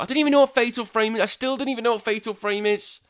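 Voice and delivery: high, loud and quick exasperated outburst; exasperated rant. Real, not acted. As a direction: rattle it off high and loud in disbelief. Ranting at listeners about Fatal Frame.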